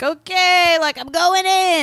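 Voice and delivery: high, loud, slow I'm going in; hammed-up pilot charging in; mock heroic cry. Pilot announcing his suicide run, hammed-up high loud cry. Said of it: "he's like".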